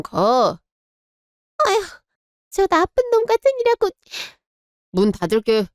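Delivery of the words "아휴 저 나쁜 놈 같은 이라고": mother cursing her son as she goes; bitter curse. Acted. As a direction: spit the insult out in disbelief.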